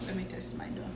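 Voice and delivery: usual speaking voice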